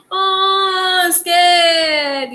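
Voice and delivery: a hammed-up frightened "oh, scared"; mock scared. Shown as a demonstration. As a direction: act scared for the kids, eyes bulging. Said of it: "Your eyes will bulge like this".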